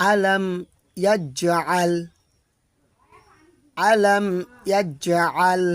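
ইয়াজআল with a voiced জ; correct recitation. Shown as a demonstration. Teacher introduces the next verse. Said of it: "তারপর দেখি".

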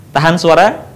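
ordinary talk

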